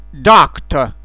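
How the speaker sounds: "Doctor" with an open o, mouth set for A; short English o. Shown as a demonstration. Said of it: "O scurt, O englezesc". ("Doctor" cu O scurt, englezesc)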